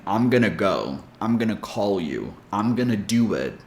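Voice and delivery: "gonna" said as one word, "gunna"; casual gonna; gonna demo. Shown as a demonstration. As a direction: model "gonna" in short phrases. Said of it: "As in".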